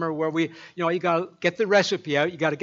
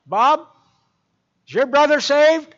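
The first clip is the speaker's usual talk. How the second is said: put-on very high, loud old lady voice; little lady calling across the living room, very high and loud; piping little lady; 10 semitones higher, 10 dB louder than usual. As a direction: pipe up like a tiny elder lady. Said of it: "piped up".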